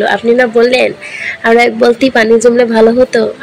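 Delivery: ordinary delivery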